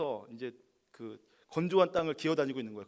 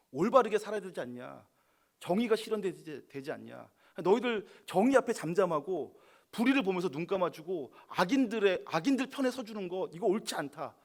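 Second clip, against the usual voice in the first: louder, higher indignant scolding; angry outburst; 5 semitones higher, 5 dB louder than usual. Acted. David scolding Saul's officials, raised angry voice. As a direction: scold them like you can't believe it. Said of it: "이렇게 화를 내는 것입니다".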